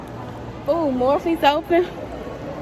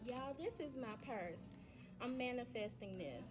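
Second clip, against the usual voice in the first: far from mic; 3 semitones lower, 36 dB quieter, faster than usual. Real, not acted.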